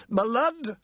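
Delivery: high, clipped barrister's reply; lightly voiced lawyer, pitched high; pompous lawyer. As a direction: answer like a self-important counsel. Mr Tangle's voice.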